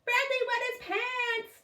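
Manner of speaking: high slow singsong taunt; teasing. A narrator's reading. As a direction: tease him like a girl on the playground. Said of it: "teased Lori".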